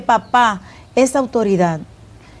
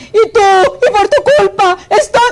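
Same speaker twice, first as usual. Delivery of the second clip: high-pitched acted nagging outburst; hammed-up shrieky accusation; mock complaining yell; 7 semitones higher, 3 dB louder than usual. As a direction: imitate a woman screaming blame. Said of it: "grita, dice palabras soeces".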